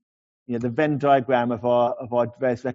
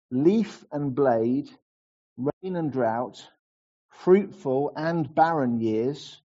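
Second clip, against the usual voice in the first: put-on slow, reverent reading of an old creed; solemn reading; slower than usual. Pastor reading the catechism answer to his church, slow and reverent. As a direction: read each pair of words slowly and let it settle.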